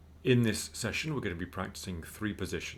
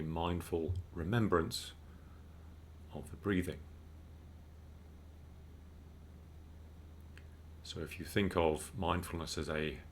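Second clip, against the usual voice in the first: calm, unhurried meditation guide; measured mindfulness instruction with spacious pauses; guided meditation; 4 dB quieter than usual. Real, not acted. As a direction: guide listeners gently into breathing, with long pauses.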